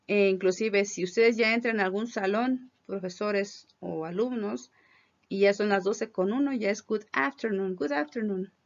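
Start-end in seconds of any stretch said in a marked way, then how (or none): none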